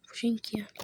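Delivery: ordinary talk, nothing marked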